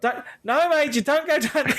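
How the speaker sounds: shouting at dog. Retold or imitated. Owner calling dog away from steep bank, desperate shout. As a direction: yell to stop the dog going down.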